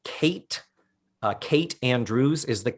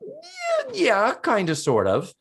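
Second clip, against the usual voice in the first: first yeah jumps way higher, then trails off sheepishly; a host imitating Natalie, squeaky and evasive; 12 semitones higher, slower than usual. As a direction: play it caught out and evasive. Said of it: "And Natalie's like".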